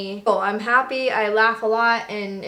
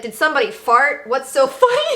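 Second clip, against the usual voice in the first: loud demand rising high into amusement; mock drill instructor; 4 semitones higher, 6 dB louder than usual. Instructor retold, questioning giggling recruits, mock-stern. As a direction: imitate an instructor's challenge, amusement breaking through. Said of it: "He's like".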